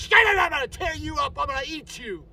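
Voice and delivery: high, loud shouted threat like a savage guard dog; shouting as the attacking dog; fierce dog voice. Retold or imitated. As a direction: voice the dog tearing into the bad guy. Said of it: "hits that blind, and goes".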